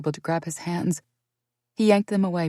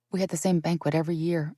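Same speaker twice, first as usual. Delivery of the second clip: usual speaking voice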